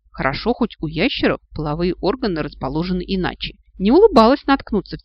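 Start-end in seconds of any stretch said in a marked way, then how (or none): none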